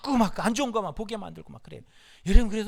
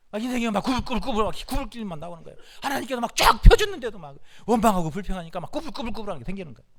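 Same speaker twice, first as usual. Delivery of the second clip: ordinary delivery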